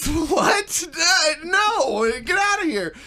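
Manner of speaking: high-pitched, incredulous mock shout; mock outraged disbelief. Host retelling junkyard clerk's reaction, mock outraged disbelief. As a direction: imitate a stunned clerk sending someone away. Retold shocked counter staff sending buyers away.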